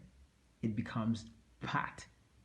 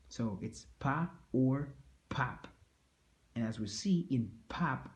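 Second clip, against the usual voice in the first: ordinary delivery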